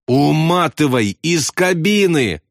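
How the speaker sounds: high, drawn-out order; frantic yell. A narrator's reading. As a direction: cry it out slowly and desperately. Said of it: "завопил Ясариан".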